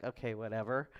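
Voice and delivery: low, offhand shrug of a reply; mock indifference. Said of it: "like, be like".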